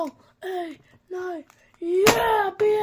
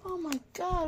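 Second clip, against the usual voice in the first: ordinary talk, nothing marked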